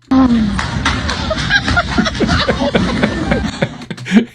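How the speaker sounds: real laughter, high and breathless; cracking up at the clip; high helpless laughter. Real, not acted. Reacting to a woman tripping in the clip, real high laughter.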